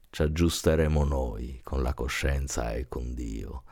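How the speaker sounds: ordinary delivery, nothing marked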